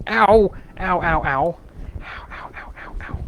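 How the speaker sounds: pained ow from a blistered finger; burnt fingertips. Real, not acted. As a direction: wince through a sore fingertip. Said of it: "I burned my finger".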